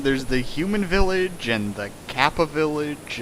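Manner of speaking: ordinary talk, nothing marked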